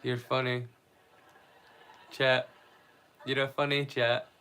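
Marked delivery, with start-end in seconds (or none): none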